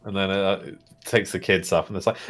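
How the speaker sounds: ordinary talk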